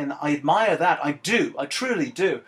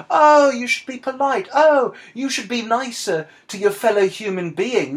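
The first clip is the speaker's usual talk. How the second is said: high, loud mock-whiny "oh"; mocking plea; 7 semitones higher, 4 dB louder than usual. Podcaster mocking people who want everyone polite, mocking plea. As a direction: exaggerate the prim pleading, pitched up.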